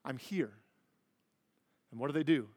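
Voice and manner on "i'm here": soft, fading hush of reassurance; calm a crying child, getting quieter and quieter; soothing a panicked child. Retold or imitated. Parent to a panicked child, soothing and fading to a hush.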